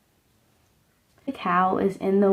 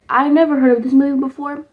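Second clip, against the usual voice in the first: quick, higher and louder burst of surprise; surprised; 5 semitones higher, 6 dB louder, faster than usual. Real, not acted. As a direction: blurt it out, genuinely amazed. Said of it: "Like, I'm surprised".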